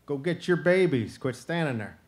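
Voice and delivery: loud urging shout, hurrying her; urgent call. Acted. Turtle shouts at the staring mama possum, loud and urgent.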